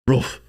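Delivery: imitated dog bark. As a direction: bark out a short, gruff-dog "roof". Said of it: "Doesn't he bark?".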